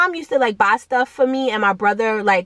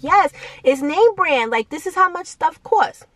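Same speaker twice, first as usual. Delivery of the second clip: put-on higher pleading teen voice insisting on the style; whiny kid; 7 semitones higher than usual. Kid arguing with mom about baggy shorts, whiny and high. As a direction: beg mom like a stubborn kid.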